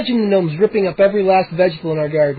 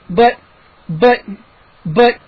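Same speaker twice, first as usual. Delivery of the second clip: stammer; 4 semitones higher, 3 dB louder, slower than usual. A narrator's reading. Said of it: "I stammered".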